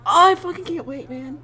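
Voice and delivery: high, loud gushing; hammed-up can't-wait squeal; eager excitement. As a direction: gush like an impatient, thrilled fan. Reenacting eager excitement at fan's art.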